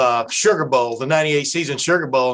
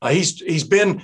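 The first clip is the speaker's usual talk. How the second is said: usual speaking voice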